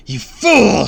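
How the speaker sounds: high, very loud villain's yell; angry shout. A narrator's reading. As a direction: shout the insult furiously. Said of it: "shouted again".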